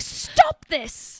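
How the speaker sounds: high, loud mock command; exasperated outburst. Imitating the horror-film wife to her partner, exasperated. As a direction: cry it out like a hammed-up movie wife. Acts out same character's outburst.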